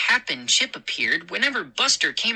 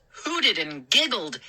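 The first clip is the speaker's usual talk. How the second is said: ordinary delivery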